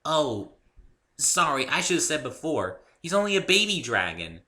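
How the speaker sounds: high, loud bouncy pony voice; bubbly pony. A narrator's reading. Pinkie explaining Spike to the newcomer, high and bubbly. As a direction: chirp it like a cheerful pony.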